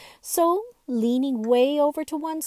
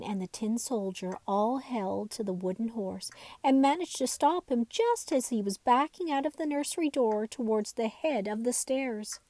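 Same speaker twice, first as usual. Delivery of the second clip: usual speaking voice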